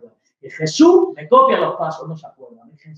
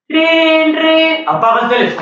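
put-on boyfriend demanding; 9 semitones higher, 6 dB louder than usual.

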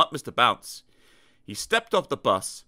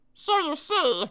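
small high character voice; slower than usual. Acted. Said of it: "said Mister Bounce finally".

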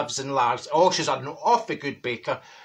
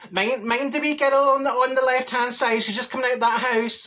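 higher-pitched, fretful warning; nagging back seat driver; 6 semitones higher than usual. Acted. Back seat passenger warning about a wee girl, nagging. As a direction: fuss at the driver in a raised pitch.

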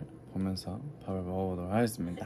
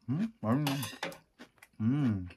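humming while chewing; 3 dB quieter than usual. Real, not acted. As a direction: mouth full of food. Seen 2: Ate a food bite at -3; closed lips, jaw working during words.

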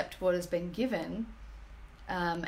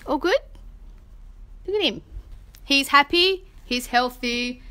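high, loud doting pet talk; gushing over the cat; 7 semitones higher, 8 dB louder than usual. Real, not acted. Owner gushing to viewers over her healthy cat, high and loud. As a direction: gush over him, beaming.

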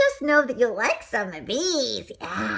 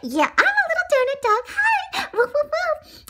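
high squeaky pup voice, ending in woofs; little donut dog. Acted. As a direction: chirp it proudly like a tiny dog. Donut dog shows off, barking.

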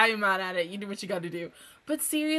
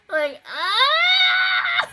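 high squealing shout of delight; excited squeal; 4 dB louder than usual. Real, not acted. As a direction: squeal it out, overwhelmed by cuteness. Fangirling over cute scene.

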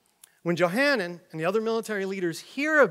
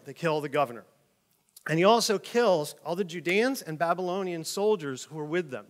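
ordinary talk, nothing marked

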